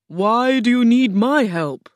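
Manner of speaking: booming Oz. A narrator's reading. Oz questions the travelling girl, booming. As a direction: boom it out like a mighty wizard.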